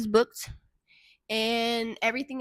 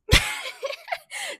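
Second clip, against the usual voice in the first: high burst of laughter. Real, not acted. Laughs at own phrase.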